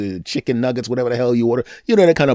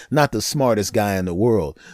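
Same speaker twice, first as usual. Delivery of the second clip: usual speaking voice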